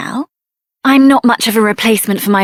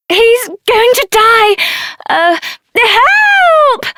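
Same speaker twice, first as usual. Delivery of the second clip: much higher, slow frightened cry; desperate call for help; high panicked plea; 10 semitones higher, much slower than usual. Acted. Companion dying, calling for help.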